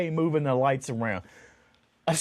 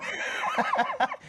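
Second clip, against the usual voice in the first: burst of laughter; 4 dB quieter than usual. Real, not acted. Laughs at own touch-grass joke.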